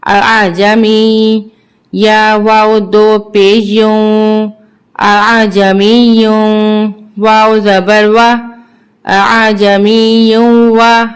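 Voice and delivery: slow, measured chant with long held vowels; Qur'anic spelling recitation. Shown as a demonstration. Teacher spelling verses for learners, measured chant. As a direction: intone each letter, then blend it.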